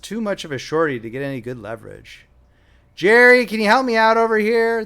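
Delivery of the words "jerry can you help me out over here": high, loud call for help. A narrator's reading. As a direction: holler to a coworker through the doors. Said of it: "called through the doors".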